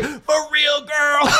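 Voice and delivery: high exclaimed hype ad-lib. Retold or imitated. Fan voicing the singer's confident aside, high imitated shout. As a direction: call it out high, like a hype woman. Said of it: "She's like".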